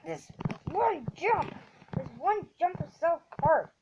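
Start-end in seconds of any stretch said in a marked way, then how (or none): 0.1-1.5 exasperated. Real, not acted. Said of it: "So mad".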